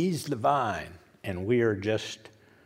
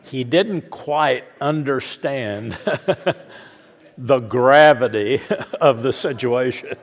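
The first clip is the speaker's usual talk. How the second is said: louder, breaking into laughter at his own joke; laughing through words; 2 semitones higher, 7 dB louder than usual. Real, not acted. Preacher laughing at his own airplane joke to the congregation, laughing. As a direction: keep laughing as you explain the joke.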